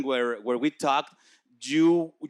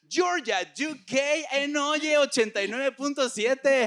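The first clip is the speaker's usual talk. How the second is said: lucha libre announcer; 10 semitones higher than usual. Acted. Host announces guest on air.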